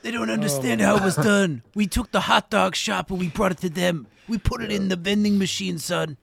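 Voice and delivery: lightly put-on gangster dad; a hint of an old-school mob boss; mock mobster father. Mobster explaining the hot dog front to his son, mock fatherly voice.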